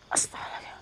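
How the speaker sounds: a breathless whispered gasp of alarm. Real, not acted. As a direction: whisper it under your breath in fear. Frightened aside during the ritual.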